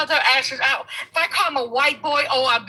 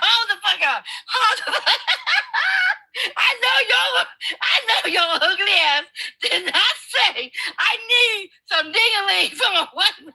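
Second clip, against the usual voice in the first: laughing shout; 7 semitones higher than usual. Real, not acted. Woman to host, laughing and shouting in shock. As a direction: cackle and yell in disbelief.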